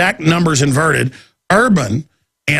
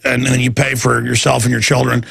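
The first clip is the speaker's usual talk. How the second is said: normal talking voice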